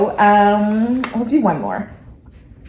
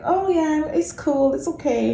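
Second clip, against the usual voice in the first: higher, lightly put-on nonchalance; mock easygoing; 7 semitones higher, 4 dB quieter, slower than usual. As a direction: pretend to brush it off. Mimics being fine with it.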